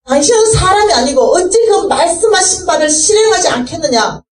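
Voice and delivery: high, pleading protest at God; crying out to God. Retold or imitated. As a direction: reproach God with his own promises. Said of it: "하나님 앞에 절규했어". (하나님께 울부짖듯 높은 목소리로 따지며)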